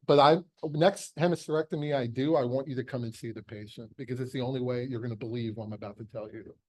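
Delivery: ordinary talk, nothing marked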